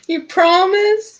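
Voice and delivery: put-on high, slow child voice; hopeful little girl. Girl seeking a promise from rescuer, hopeful child voice. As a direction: ask in a small high voice, needing assurance.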